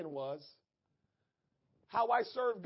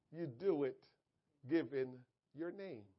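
ordinary talk

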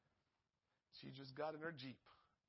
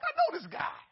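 excited recognition; 10 dB louder than usual. Retold or imitated. Recognizing a familiar face.

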